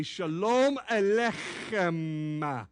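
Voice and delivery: slow, careful Shalom Aleichem with a throaty ch; teaching the congregation "Shalom Aleichem" with slow Hebrew sounds; Hebrew greeting demo. Shown as a demonstration. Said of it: "Repeat after me".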